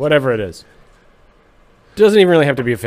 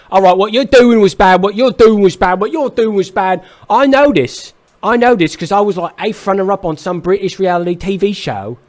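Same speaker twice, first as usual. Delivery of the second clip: loud, high British telling-off; hammed-up angry Brit chewing out a band; British accent; 9 semitones higher, 8 dB louder than usual. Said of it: "That was a really good accent".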